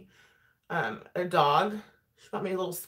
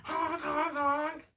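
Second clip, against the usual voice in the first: mock honking; 9 semitones higher, 4 dB quieter, much slower than usual. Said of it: "I'm like".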